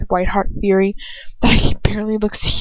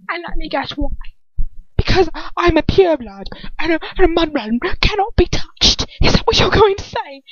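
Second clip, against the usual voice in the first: mocking villain; 8 semitones higher than usual.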